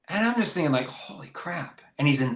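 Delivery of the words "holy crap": breathless whispered aside; whispered thought. Retold or imitated. Thinking while listening to the crying kid, whispered. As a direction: whisper it under your breath, stunned.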